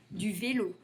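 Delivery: ordinary talk, nothing marked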